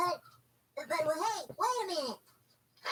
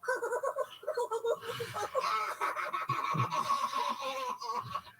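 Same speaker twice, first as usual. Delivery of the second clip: wild laugh; 7 semitones higher than usual. Acted. Kid cackling at the prank photo, shrieking laugh. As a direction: howl with laughter at the joke.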